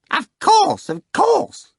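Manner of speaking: high, loud eager cry; a fawning jester crying out; Korofiov crying out. Acted. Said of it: "cried Korofiov".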